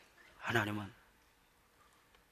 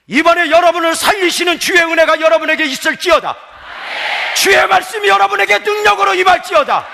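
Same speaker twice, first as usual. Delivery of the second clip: very high, loud pulpit shout; full-force preaching; 18 dB louder than usual. Real, not acted. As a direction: build into a roaring blessing and prayer. (목청껏 외치는 부흥회 설교)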